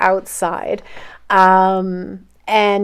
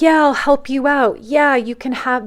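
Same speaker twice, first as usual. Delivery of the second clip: lightly mocking, higher sing-song promise; mock fake helper; 6 semitones higher than usual. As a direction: imitate someone promising what they won't give. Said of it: "They'll be like".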